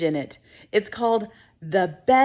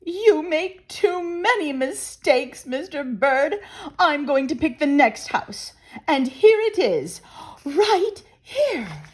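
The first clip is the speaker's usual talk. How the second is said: higher, bossy bird voice; high bossy wife bird scolding; scolding Missus Bird; 6 semitones higher than usual. Acted. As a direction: nag him and take charge.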